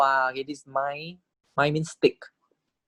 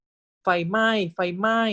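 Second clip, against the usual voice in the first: higher, slightly hurried pretend shout of ไฟไหม้; teacher playing an alarmed bystander; mock alarm in Thai; 6 semitones higher, faster than usual. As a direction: call ไฟไหม้ as if spotting a fire, lightly acted. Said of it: "you'll say".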